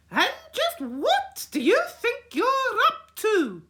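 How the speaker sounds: very high loud angry granny; angry granny telling off; furious. A narrator's reading. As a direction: scold the naughty children. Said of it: "colossal with fury".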